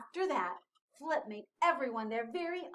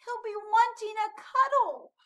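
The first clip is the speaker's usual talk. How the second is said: high, louder little-penguin whine; raised, pleading character voice; sad and plaintive; 10 semitones higher, 7 dB louder than usual. A narrator's reading. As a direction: fret tearfully over a lost friend. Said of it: "He's very sad".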